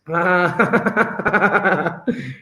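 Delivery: long, open laugh. Real, not acted. Teacher amused by the cat they drew, laughing.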